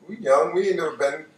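ordinary delivery

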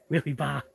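"wheelie ba" with the R dropped, ending on an open "ah"; Boston R-dropping. Shown as a demonstration. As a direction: say "wheelie ba" like a Massachusetts local, no R at the end. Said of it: "We don't pronounce our R's".

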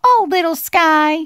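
high, loud mouse awe; high storybook wonder; gasped amazement. Acted. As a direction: exclaim it as an astonished little mouse. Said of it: "he gasped".

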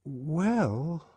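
put-on high, drawn boyish hesitation; tentative schoolboy opening; hesitant. A narrator's reading. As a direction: start uncertainly, then stop. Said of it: "and hesitated".

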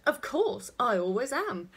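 smugly sure of herself; imitating a know-it-all child, pushed loud. A narrator's reading. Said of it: "she looked so smug".

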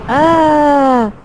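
loud startled ah; frightened cry. Acted. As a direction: jump at the thunder. Storm fright.

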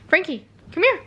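high, rising call to the kitten; coaxing a kitten to come; calling the cat. Real, not acted. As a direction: sing his name up to lure him over.

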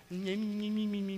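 high, soft squeaky babble; mock "me-me-me" noises. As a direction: imitate a silly couple in tiny high squeaks. Mimics himself with nasty girlfriend.

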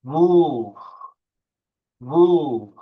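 "Wolf" with a drawn-out oo, loud; loud model of "Wolf", long u sound; lengthened u. Shown as a demonstration. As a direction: stretch the vowel in "Wolf" like "wooolf". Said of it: "Bakın U'yu uzatıyorum".

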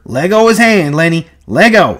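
high, loud urgent command; frantic repeated yell; shouting. A narrator's reading. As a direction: shout to break his grip. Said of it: "George shouted over and over".

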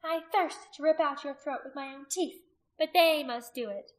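emphatic malicious threat; vicious taunt. A narrator's reading. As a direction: threaten a captive with relish. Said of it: "she mocked".

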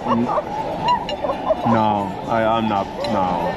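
slow, drawn-out repeated no; dramatic refusal. Real, not acted. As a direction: refuse it with theatrical disgust. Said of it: "You are a dramatic one".

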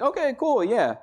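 high, overly chipper okay cool; fake upbeat response. Pastor mimicking his reply to griping lady, high and chipper. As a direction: humour her with a bright fake cool. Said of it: "I'm like".

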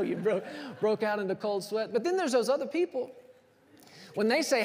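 ordinary talk

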